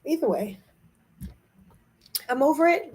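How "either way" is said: tired whisper; barely voiced, drained; quietly drawing a line. Real, not acted. Weary shift after pause.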